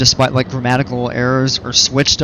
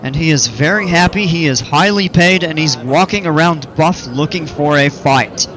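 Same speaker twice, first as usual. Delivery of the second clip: showman announcer; 4 semitones higher than usual. Acted. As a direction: present him like a ringmaster to the crowd. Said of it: "right here I present to you".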